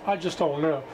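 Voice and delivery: usual speaking voice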